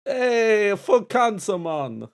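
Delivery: loud, high hammed-up Jamaican accent; over-the-top Jamaican accent, high and loud. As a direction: shout it out like a caricature Jamaican. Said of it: "in a Jamaican accent".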